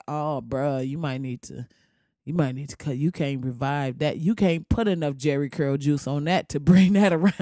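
deadpan low friendly roast; low dismissive voice. Retold or imitated. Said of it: "just like".

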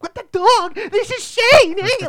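shrieking, very high and loud, slow; frantic mom. Retold or imitated. Mocking the anxious movie mom, shrieking high. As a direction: scream it like a coked-up mom. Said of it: "She's just like".

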